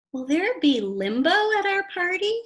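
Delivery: put-on high, bright eager question from a boy; high excited boy asking; eager child voice. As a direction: ask it like an excited kid. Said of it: "asked Stanley".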